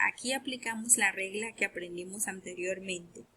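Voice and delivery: ordinary delivery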